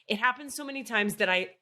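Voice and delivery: ordinary delivery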